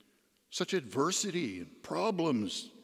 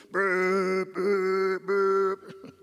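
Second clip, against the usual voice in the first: louder, higher monotone boops; imitated alarm buzz; 5 semitones higher, 4 dB louder, much slower than usual. Alarm going off as he drifts off, imitated booping. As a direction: mimic a steady electronic alarm on one note.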